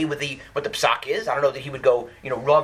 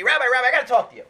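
retold yell; 8 semitones higher, 3 dB louder, much faster than usual. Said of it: "yells out to me".

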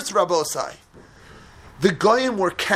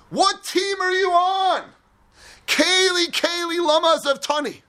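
much higher, crying shout to God; crying plea; 9 semitones higher than usual. Acted. Esther in the idol-filled palace, crying shouts at God. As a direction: demand to know whose side He is on.

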